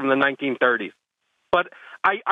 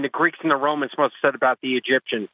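ordinary delivery

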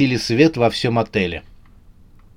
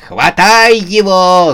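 high, loud, drawn-out yell; 7 semitones higher, 7 dB louder, slower than usual. A narrator's reading. Сюзанна sends everyone after the runaway, yelling. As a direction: holler the order to grab. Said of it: "заорал".

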